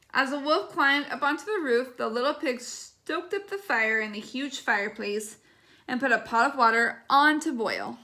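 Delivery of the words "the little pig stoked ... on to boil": usual speaking voice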